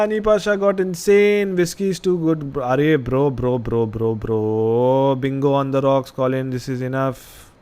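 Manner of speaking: ordinary talk, nothing marked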